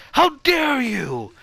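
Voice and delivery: high, loud, very slow put-on indignation; mock outrage. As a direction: drag out the offended gasp. Character offended.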